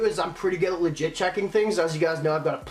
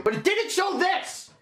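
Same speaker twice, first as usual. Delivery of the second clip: much higher, slow, exasperated protest; angry outburst; 12 semitones higher, slower than usual. Real, not acted. Vlogger ranting about a fake item he bought, high exasperated protest.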